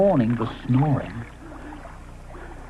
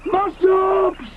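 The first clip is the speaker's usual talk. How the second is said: high shouted name; 3 dB louder than usual. Acted. Ally wakes Moschops by shouting in his ear, high shout. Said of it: "he shouted".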